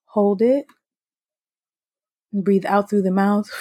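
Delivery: calm breathing coach voice; soothing guided breathing exercise. Acted.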